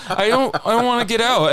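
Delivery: high, mock-frightened whine; scared pleading. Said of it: "I was so scared".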